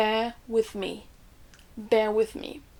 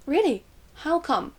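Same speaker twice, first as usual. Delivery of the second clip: surprised; 5 semitones higher, 4 dB louder than usual. Acted. Acted reaction to a cancelled trip.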